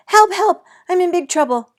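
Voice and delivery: high, loud panicked cry; yelling for help. A narrator's reading. Girl yells to mother about trouble. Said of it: "I yelled to my mother".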